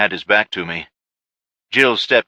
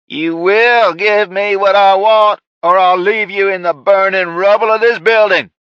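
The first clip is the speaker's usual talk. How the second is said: Ray's shouting; 12 semitones higher, 6 dB louder than usual. Acted. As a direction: bellow it like a madman boss. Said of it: "Ray shouted".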